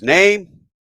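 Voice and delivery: loud, high rising demand; brusque army roll-call question; official demand. Retold or imitated. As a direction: call it out like a busy sergeant. Sergeant demands a name at desk.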